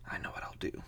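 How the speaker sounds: near-whispered thought to himself; low, secretive whisper; hushed scheming. Acted. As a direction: murmur it very softly as a secret plan. Toad thinking to himself, plotting.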